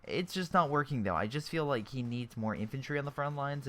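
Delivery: usual speaking voice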